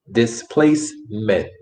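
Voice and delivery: "Displacement" slowly, with a gap before "ment"; slow syllables. Shown as a demonstration. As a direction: set off the last syllable of "Displacement" and sound its t. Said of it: "That word is pronounced".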